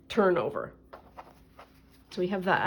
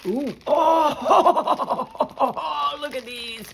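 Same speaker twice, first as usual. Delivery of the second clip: delighted squeal; 6 semitones higher, 4 dB louder, much slower than usual. Real, not acted.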